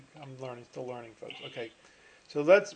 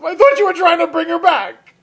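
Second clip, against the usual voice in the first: much higher and louder, eager punchline voice; punchline driver; 11 semitones higher, 13 dB louder than usual. Acted. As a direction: blurt it out as the hopeful driver. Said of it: "He says".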